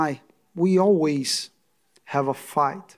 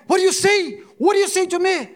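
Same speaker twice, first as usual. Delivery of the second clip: shouting back much higher and louder; angry retort; 11 semitones higher, 5 dB louder, faster than usual. Retold or imitated. Husband yelling back at his screaming wife. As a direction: fire the question back at her in anger.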